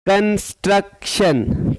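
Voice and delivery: "कंस्ट्रक्शन" slowly in three beats, कन, स्ट्रक, शन; split into syllables. Shown as a demonstration. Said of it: "three syllable".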